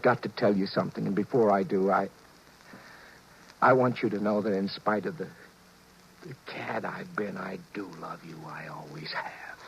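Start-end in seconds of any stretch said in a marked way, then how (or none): 6.3-9.6 hushed, breathy whisper of love; whispered confession. Acted. Husband whispers his guilty love to his wife.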